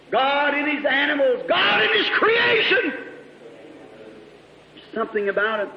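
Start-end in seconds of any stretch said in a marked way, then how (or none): 0.1-2.9 building preacher refrain. Acted. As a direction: climb to a shout on creation.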